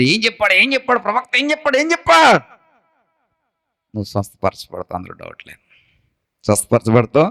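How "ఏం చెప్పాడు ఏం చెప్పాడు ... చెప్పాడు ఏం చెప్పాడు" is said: eager asking. Acted. King eagerly quizzing Hazael about the prophet's words, building. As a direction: press the question more eagerly each time. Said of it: "కుతూహలంగా అడుగుతున్నాడు".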